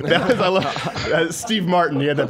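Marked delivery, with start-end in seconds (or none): none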